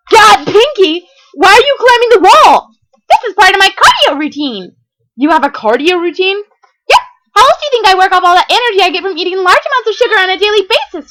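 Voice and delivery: very high, loud, bouncy voice; bubbly party pony. Acted. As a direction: chirp it like a hyper party pony. Pinkie's bubbly voice.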